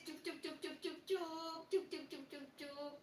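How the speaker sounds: soft sing-song shushing, in little repeated pulses; playful soothing. Pretend. Host comforts a crying viewer, playful soothing. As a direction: coo softly as if hushing a crying child.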